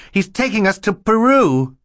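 very high, loud desperate cry; shouting. A narrator's reading. Klaus warns Mister Poe of the plan, shouting. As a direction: shout the accusation, lifting on "Peru". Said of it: "Klaus shouted".

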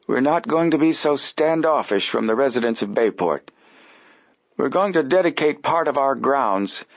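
put-on high, formal old man voice; stiff old gentleman. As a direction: announce it like a stuffy old man. Applegate voiced by narrator.

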